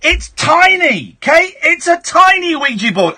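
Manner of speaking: pitched well up, emphatic; excited reveal. Real, not acted. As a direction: build up the news with comic exasperation. Excitedly reveals the tiny board.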